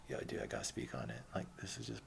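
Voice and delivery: low, soft, laid-back confrontation; very chill. Retold or imitated. Speaker calling out a hostel guy, very chill. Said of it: "I'm very chill".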